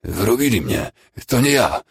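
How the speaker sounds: put-on high, strained croak of a wounded boy. A narrator's reading. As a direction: croak out a desperate protest. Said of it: "zacharczał chłopak". (chrapliwy, podniesiony głos rannego)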